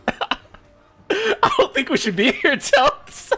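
very high voice, breaking into laughter; laughing squeal. Real, not acted. Gamer telling friend they should leave, high laughing squeal. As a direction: squeal it helplessly through laughs. Repeats worry to friend, cracking up.

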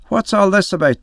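storyteller's voicing of an irate priest; angry priest. A narrator's reading.

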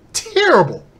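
hammed-up high shout of "terrible"; mock outraged cry. Climax of the repeated word.

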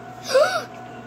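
high pretend gasp; shocked gasp. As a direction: gasp sharply in mock shock. Finds the knife missing.

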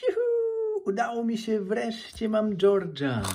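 real jubilation. Real, not acted. Collector finally gets George from the egg, jubilant. Finds the wished-for George figure.

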